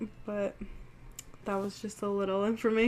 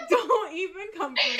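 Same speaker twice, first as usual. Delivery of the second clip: laughing playful protest, pitched higher; 8 semitones higher than usual. Real, not acted. Teasing her friend back, laughing playfully. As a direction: toss it back through a laugh.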